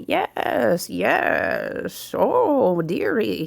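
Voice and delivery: high, creaky crone cooing at a guest; playful mock witch voice; old crone voice. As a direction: purr it like a fairy-tale witch luring you in. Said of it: "So this crone lady".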